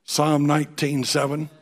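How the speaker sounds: loud, ringing pulpit voice; booming sermon delivery; forceful preaching. Acted. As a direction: proclaim the verse to the room. Preaching scripture at full force.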